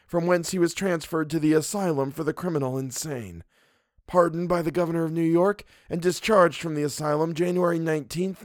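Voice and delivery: put-on high, dry old man reading a ledger; Mister Wilde reading. A narrator's reading. Wilde reading Vance's record aloud, high and dry. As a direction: recite the file coldly, like a clerk.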